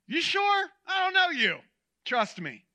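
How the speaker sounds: high, loud, sceptical reply; doubtful fisherman. Acted. Fisherman answers a stranger on the bank, doubtful. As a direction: question the stranger with open doubt.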